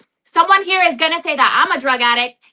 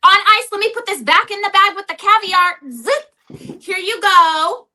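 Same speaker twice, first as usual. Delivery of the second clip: mock presentation; 6 semitones higher than usual. Mock handing over urine sample.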